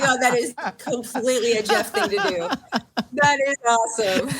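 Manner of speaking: laughing through the words, fast. Real, not acted. Laughing about her old co-host Jeff. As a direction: chuckle while saying it. Laughing over a friend's quirk.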